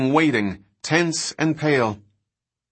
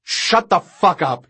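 raised-pitch, furious command; angry shout; 5 semitones higher than usual. Acted. Dodgson silences his panicking passenger, shouting angrily. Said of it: "he shouted".

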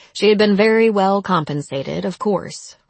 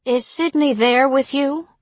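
higher-pitched, concerned question; anxious elderly neighbor; 7 semitones higher than usual. A narrator's reading. Neighbor calling Rob about Sydney, anxious. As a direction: ask it with mild worry, voice lifted.

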